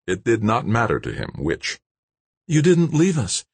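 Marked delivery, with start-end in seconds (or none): 2.5-3.4 high, mildly breathless woman's voice; almost breathless. A narrator's reading. Kimberly thanks her rescuer, almost breathless. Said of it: "Kimberly said, almost breathless".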